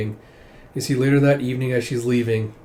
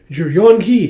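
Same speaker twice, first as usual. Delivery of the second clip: loud, higher exclamation of recognition; raised, delighted-sounding greeting; excited greeting; 7 semitones higher, 9 dB louder, slower than usual. Acted. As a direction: exclaim it as someone long absent turns up. Relatives greet long-absent tribe member.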